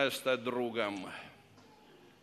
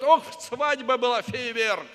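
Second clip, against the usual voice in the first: mock excitement; 9 semitones higher, 9 dB louder than usual. Mocking people's wedding talk.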